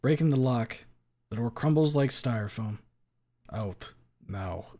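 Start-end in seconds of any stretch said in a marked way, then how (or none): 3.5-4.7 put-on low, soft menacing Russian accent; quiet Russian threat. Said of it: "thick Russian accent".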